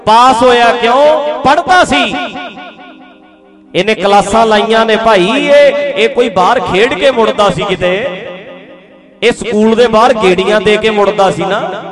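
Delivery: preaching at full force. Real, not acted. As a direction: preach it out with fire. (ਜੋਸ਼ੀਲਾ ਪ੍ਰਚਾਰ)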